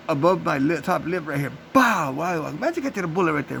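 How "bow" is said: high loud shouted bow; gunshot bow. Retold or imitated. Bullet to the face, loud shouted bow. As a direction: blast the bow out.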